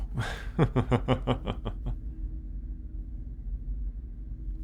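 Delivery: soft airy cruel laugh; a quiet cruel little laugh; wry chuckle. Acted. Captor joking the captive is now rare, wry chuckle.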